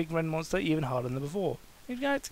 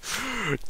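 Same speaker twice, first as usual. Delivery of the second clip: sharp gasp for air; big gasp; 8 semitones higher than usual. Acted. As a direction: gasp in a huge breath. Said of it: "took a huge deep breath".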